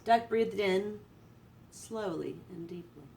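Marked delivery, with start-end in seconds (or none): 1.7-3.0 whispered breath. A narrator's reading. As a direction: breathe the words out softly. Duck meditating breath.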